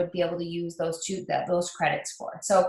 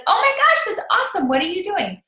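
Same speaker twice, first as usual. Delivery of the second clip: much higher, gushing "Oh my gosh"; excited friend; 11 semitones higher, 4 dB louder than usual. Retold or imitated. The friend on the phone reacts, loud and thrilled. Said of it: "And your friend will be like".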